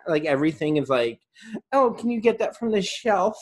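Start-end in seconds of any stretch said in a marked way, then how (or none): 1.7-3.4 put-on high sweet girly voice. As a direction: play a helpless girly girl, high. Mocking girly girls.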